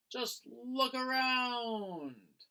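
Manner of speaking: lightly voiced Daisy; dreamy drawn-out advice. A narrator's reading. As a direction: stretch it out like a know-it-all friend.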